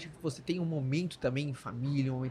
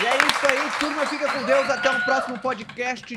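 high, loud goodbye; excited sign-off; 10 semitones higher, 8 dB louder than usual. Real, not acted. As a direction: wrap it up with energy. Energetic sign-off.

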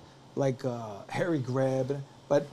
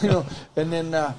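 normal talking voice

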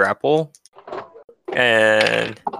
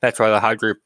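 usual speaking voice

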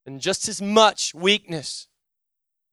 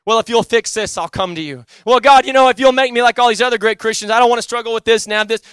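louder and higher, a pleading bargain with God; a hammed-up deal-making prayer, rising fast at the end; mock bargaining prayer; 6 semitones higher, 10 dB louder than usual. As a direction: bargain with God, half-demanding. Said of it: "It wasn't".

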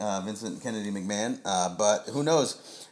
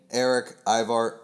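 normal talking voice

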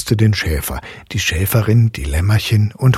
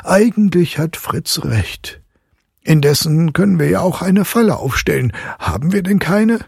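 high, slowed considering voice; father agreeing, then pondering; thoughtful father; 11 semitones higher, 3 dB louder than usual. A narrator's reading. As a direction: muse aloud about a trap. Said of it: "sprach der Medizinalrat".

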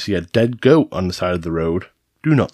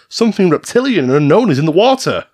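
higher-pitched, acted-out witness quote; helicopter crew report; 7 semitones higher, 4 dB louder than usual. Host quotes the helicopter crew's sighting, higher acted voice. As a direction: report it like a startled crew member. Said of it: "The helicopter crew said".

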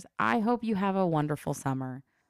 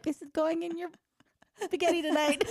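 much higher, playful proclaiming voice; mock announcement; 11 semitones higher than usual. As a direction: announce it like a parade. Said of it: "You're like".

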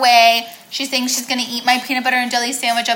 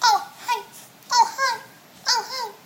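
high cooing to dog; 10 semitones higher, 6 dB quieter than usual. Pretend.

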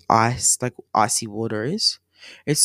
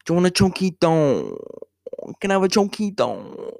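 silly voice; 5 semitones higher than usual. Retold or imitated. Silly voice in lolly section.

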